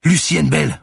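high, loud yell of a name; screamed, urgent self-identification; insistent shout. A narrator's reading. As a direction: shout your name to get through to her. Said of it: "reprit-elle".

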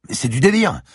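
high, loud outraged cry; cried out. Acted. Siberski crying out at the secrecy order. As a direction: burst out in disbelief. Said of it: "s'écria Siberski".

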